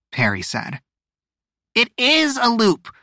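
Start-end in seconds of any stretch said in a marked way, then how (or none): 1.8-2.9 high, huffy insistence. A narrator's reading. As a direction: huff it in a high character voice. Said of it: "Perry huffed".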